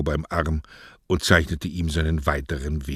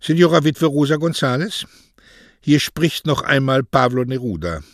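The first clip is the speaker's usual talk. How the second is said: higher, louder formal greeting; formal phone call; 8 semitones higher, 6 dB louder than usual. A narrator's reading. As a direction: announce yourself grandly on the phone. Poet phones the widow. (Neruda am Telefon, förmlich laut)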